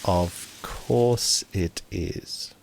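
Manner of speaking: slow and hesitant; a slow, hesitant answer; slowly. Acted. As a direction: draw the words out slowly, unsure. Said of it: "And she slowly signs".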